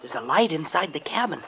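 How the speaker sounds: soft, low observation; hushed report. Acted. Sonny telling his boss what he sees, in a hushed voice. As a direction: murmur it so nobody inside hears. Sneaking up on a cabin.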